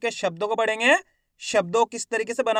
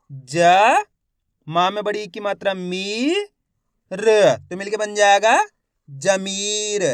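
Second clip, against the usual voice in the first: slow, long-held letters, louder on the single sounds; syllable-by-syllable demo; 4 dB louder, much slower than usual. Shown as a demonstration. Teacher has learners copy the method, drawn-out sounding out. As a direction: stretch each sound, then draw out Zameer. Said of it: "एक ही अक्षर का उच्चारण कर रहा हूँ".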